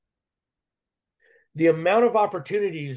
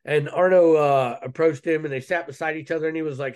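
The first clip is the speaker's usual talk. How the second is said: ordinary talk, nothing marked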